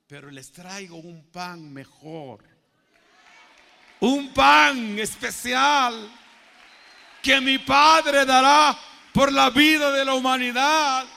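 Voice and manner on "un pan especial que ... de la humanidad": loud, slow proclamation to a congregation; full-force preaching. Acted. Preacher promising the congregation a special bread, full-force proclamation.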